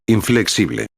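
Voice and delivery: usual speaking voice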